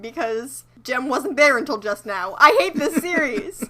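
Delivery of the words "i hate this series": higher, louder exasperated groan. Real, not acted. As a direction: blurt it out, fed up and loud. Repeats complaint, second time louder.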